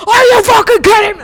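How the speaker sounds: shouted high and loud in disbelief; loud, high, furious disbelief; outraged yell. Retold or imitated. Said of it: "I'd be like".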